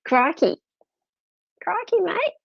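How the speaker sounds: high, hammed-up Aussie "Crikey, mate"; hammed-up Australian. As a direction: play a cartoon Aussie calling out. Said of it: "You sound like an Aussie".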